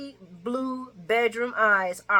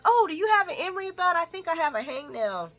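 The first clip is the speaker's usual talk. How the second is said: high, quick, sugary ask; a put-on sweet voice to a stranger; mock sweet Lucy; 8 semitones higher, faster than usual. Lucy asking a woman for an emery board, in a high sweet voice. Retelling Lucy's question in soap.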